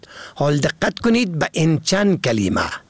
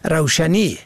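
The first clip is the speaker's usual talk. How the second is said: ordinary talk, nothing marked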